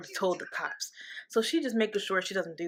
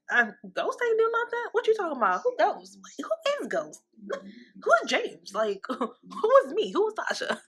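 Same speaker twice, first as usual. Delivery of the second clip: high, incredulous feigned confusion, much higher; mock playing dumb; 7 semitones higher than usual. Tasha dodging the wire, hammed-up playing dumb. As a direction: act like you never heard the name. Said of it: "And now she's like".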